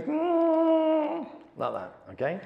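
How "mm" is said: a long hum, much higher and louder; high head hum. Shown as a demonstration. Teacher showing the hum in his head, loud and high. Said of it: "all my head can do is".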